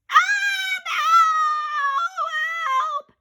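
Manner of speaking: high, loud crying scream; terrified cry for help. Acted. Boy screaming as bride leans in. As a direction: shriek in panic.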